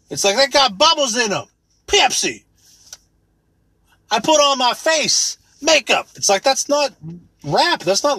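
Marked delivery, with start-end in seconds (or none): 0.4-6.1 mock rapper. Mocking rap lyrics.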